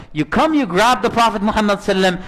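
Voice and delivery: ordinary talk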